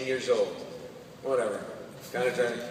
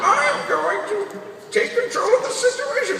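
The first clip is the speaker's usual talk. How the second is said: loud, straining hammed-up voice; constipated Batman; 6 dB louder than usual. Batman on the toilet taking control, loud comic straining. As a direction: push it out as if badly constipated. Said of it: "someone who really constipated".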